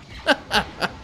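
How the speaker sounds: short burst of chuckles; laughing. Real, not acted. Player scoffing at any danger, short laugh. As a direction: laugh off the worry.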